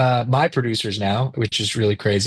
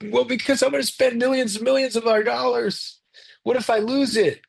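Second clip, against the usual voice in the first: high-pitched mock tearful panic; terrified, crying; 11 semitones higher than usual. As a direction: whimper it high, imitating someone scared of losing everything. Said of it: "why do you sound all terrified".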